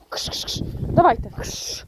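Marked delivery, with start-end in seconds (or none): 0.1-0.6 soft voiceless shoo-shoo at the birds; shooing hiss. Real, not acted. Shooing chickens home. (тихе шипіння кш-кш на курей)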